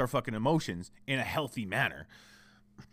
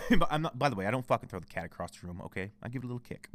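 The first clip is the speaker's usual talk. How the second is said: ordinary talk, nothing marked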